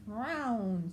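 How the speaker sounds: hissed s, then slow snake voice; Snake hissing. Acted. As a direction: start with a hiss, drag the words like a snake. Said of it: "said Snake".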